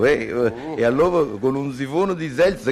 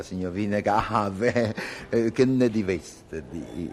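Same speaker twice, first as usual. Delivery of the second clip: slow teasing drawl; 6 semitones lower, 4 dB quieter, much slower than usual. Acted. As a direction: drag it out slowly. Drawn-out suggestive pause before offer.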